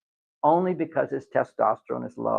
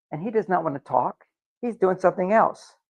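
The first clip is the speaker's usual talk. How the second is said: ordinary talk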